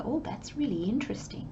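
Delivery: hushed whispered aside. Retold or imitated. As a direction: murmur it to yourself under your breath. Voicing your own private reaction.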